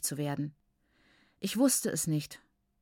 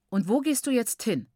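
stern questioning, slightly raised; 4 semitones higher, 4 dB louder than usual. A narrator's reading. Father questions his daughter leaving the table, stern. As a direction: demand an answer sternly. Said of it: "fragte Baba Mukuru streng".

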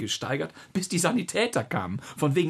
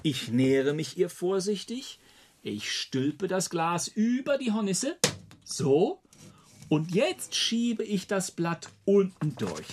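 slow, deliberate step-by-step voice; a father calmly showing his daughter how to trap a hornet; careful; 3 semitones higher, slower than usual. Acted. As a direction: narrate each move slowly and carefully.

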